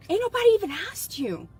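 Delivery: sassy retort. Real, not acted.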